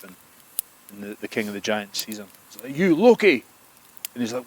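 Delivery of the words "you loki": higher, louder call across the hall. Acted. Giant king to Loki, a loud high call. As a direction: shout him down from above.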